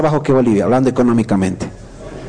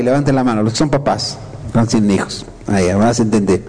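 ordinary delivery

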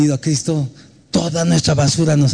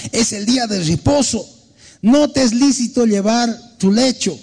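stern mock scolding; 7 semitones higher than usual. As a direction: lay down the rule sternly. (reprimenda severa y aguda)

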